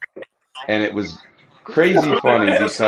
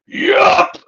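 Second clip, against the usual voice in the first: high, strained squeak; mimicked kid's strained answer; straining little kid; 4 dB louder than usual. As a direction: squeeze it out like a kid mid-push. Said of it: "Are you planking or are you shitting".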